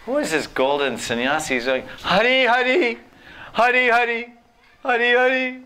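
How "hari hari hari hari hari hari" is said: high, loud crying call of the name; imitating a devotee's weeping chant. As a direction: cry out the name in longing. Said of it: "He's just crying".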